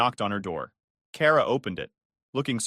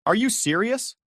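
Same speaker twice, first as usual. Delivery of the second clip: voice breaking; 10 semitones higher, 3 dB louder than usual. Acted. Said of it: "my voice breaking".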